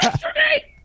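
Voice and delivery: very high, squeaky indignant disbelief; mock outraged extra. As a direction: play the jealous extra shrieking in disbelief. Indignant extra questioning him.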